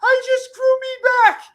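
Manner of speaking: very high, loud, shrieking curse; hammed-up screeching scream. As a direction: shriek at her in pain and rage. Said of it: "he starts screaming".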